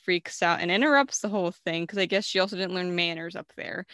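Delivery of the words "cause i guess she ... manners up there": normal talking voice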